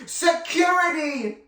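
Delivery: high, slow pointed announcement; dramatic reveal. A narrator's reading. April dramatically naming the sacrifice, high and slow. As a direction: fling the name out dramatically. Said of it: "she pointed dramatically".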